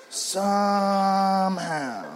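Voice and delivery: very slow stretched somehow; drawn out. Acted. Comic echoing his own point, very slowly drawn out. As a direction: let it hang, slowly.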